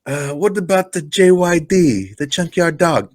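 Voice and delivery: high, hammed-up accented questioning; badly faked wrestler accent, hopefully prying; Iron Sheik. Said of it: "your Iron Sheik is awful".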